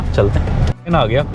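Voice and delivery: usual speaking voice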